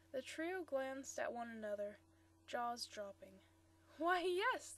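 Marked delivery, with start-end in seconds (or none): 4.0-4.7 higher, louder burst of pleased surprise; pleased exclamation with a touch of laughter; an understated laughing reply; mild laughing delight. A narrator's reading. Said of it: "the girl laughed".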